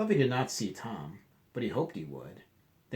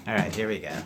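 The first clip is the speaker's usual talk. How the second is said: plain reading voice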